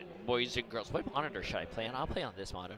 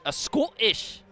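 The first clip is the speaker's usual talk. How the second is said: put-on higher, louder clipped Scottish accent try; mock Scottish accent; 12 semitones higher, 10 dB louder, slower than usual. Streamer retries the Scottish accent for chat, mock and loud. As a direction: give the Scottish accent another shaky go. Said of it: "I can't do a Scottish".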